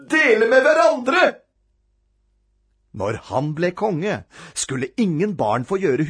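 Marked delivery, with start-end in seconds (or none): 0.1-1.3 high, loud wail; utter horror. A narrator's reading. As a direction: cap the list with a horrified cry. Climax of the dreaded list.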